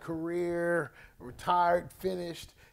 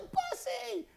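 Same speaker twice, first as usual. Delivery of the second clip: retold yell; 5 dB quieter than usual. Coach greeting his old player after years, high mock yell. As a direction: sing out the nickname in a high mock holler. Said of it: "and he yells".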